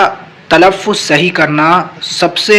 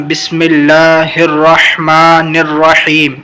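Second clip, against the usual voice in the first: slow, measured recitation of Bismillah; much slower than usual. Shown as a demonstration. Teacher reciting before the lesson, slow. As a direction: recite it slowly and reverently.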